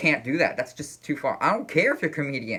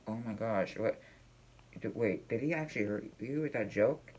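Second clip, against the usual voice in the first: quiet, softer aside; hushed worried concern; 9 dB quieter than usual. Pretend. Husband quietly asking his hurt wife, hushed concern. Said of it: "and I'm just like".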